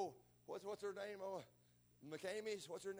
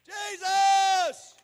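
desperate full-voice shout, very high; hollering out; 29 dB louder, much slower than usual. Retold or imitated. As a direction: cry out for Jesus with everything. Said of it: "Pain make you holler louder".

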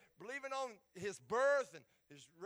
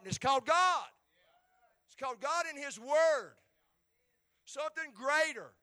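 shouted, much higher and louder; preaching shout; 6 semitones higher, 13 dB louder than usual. Real, not acted. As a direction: proclaim it to the whole church. Sermon climax, shouting God.

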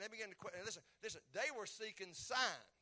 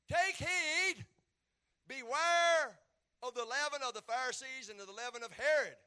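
loud, high proclaimed warning; stern charge; 6 semitones higher, 12 dB louder than usual. Acted. Preacher voicing Jesus charging the disciples, loud warning. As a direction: boom out the warning to the followers. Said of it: "he charged them".

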